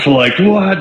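high, loud, incredulous what; stunned disbelief. Real, not acted. To the host, disbelief at his first big paycheck, surprised. Paid four thousand dollars.

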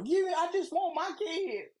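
mock baby mama.

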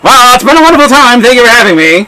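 put-on booming old-time radio host voice; old radio announcer. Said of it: "old-time radio announcer?".

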